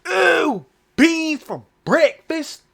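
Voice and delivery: high, drawn-out mock-horrified "ooh"; slow, loud, high-pitched ridicule; mocking disgust. Jeering at British food, mocking disgust.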